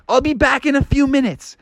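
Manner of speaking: high-pitched voice tossed over the shoulder; frog calling back. Acted. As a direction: call back while dashing off. Froggy calls back while dashing.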